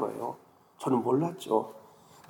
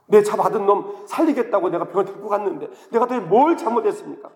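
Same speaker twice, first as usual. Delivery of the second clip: crying out to God; 7 semitones higher than usual. Retold or imitated. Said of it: "막 부르짖는 거예요".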